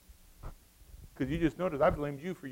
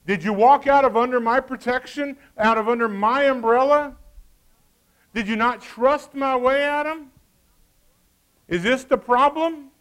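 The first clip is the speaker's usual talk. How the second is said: loud, pressing questions; God pressing Adam about the tree, loud; stern questioning; 8 semitones higher, 12 dB louder than usual. Acted. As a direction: press him hard with each question.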